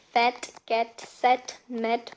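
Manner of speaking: "fat cat sat mat" very slow, stressing each ending; teacher's slow demo, equal stress on "fat cat sat mat"; stressed word endings. Shown as a demonstration. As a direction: space out "fat cat sat mat", leaning on every vowel. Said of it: "we stress by, while pronouncing".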